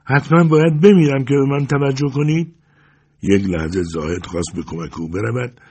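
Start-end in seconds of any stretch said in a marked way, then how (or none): 0.0-2.5 high, plaintive reproach; raised, aggrieved lament; mournful voice. A narrator's reading. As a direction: plead for attention, sorrowfully. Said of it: "با صدای محزونی گفت".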